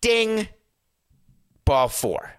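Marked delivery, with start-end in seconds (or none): 0.0-0.5 high sung ding like a tech chime; bright electronic ping, mouth-made; imitated ding. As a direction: chime it out high, like the replay bell. Mimicking a challenge system ping.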